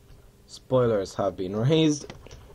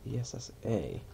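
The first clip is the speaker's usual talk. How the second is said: usual speaking voice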